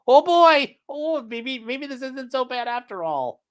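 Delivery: mock him in a high voice, loud at first; high mocking impression, easing in volume; Nick. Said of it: "And Nick will be like".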